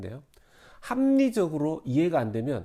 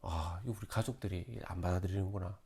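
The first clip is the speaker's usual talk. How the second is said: resigned aside; 6 semitones lower, 10 dB quieter than usual. Acted. Imagined inner resignation.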